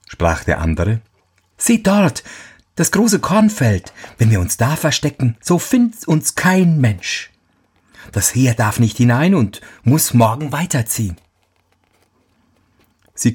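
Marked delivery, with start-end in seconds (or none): 1.6-11.2 put-on raised young soldier voice. Soldier speaks in story.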